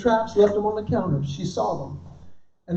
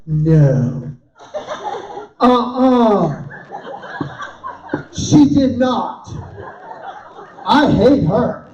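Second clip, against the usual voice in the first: woman gossiping on phone; much slower than usual. Retold or imitated. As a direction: play a woman reacting to juicy gossip. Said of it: "Listen to her on her phone".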